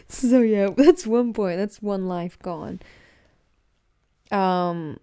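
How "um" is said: usual speaking voice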